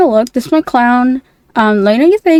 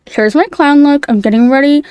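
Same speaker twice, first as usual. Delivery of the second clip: ordinary delivery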